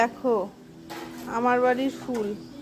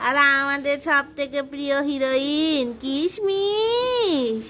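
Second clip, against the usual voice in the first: slow, stretched build-up to the name; drawn-out introduction; much slower than usual. Real, not acted. Presenting our favourite heroine Kishmish, drawn-out introduction. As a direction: draw out the name like a star's entrance.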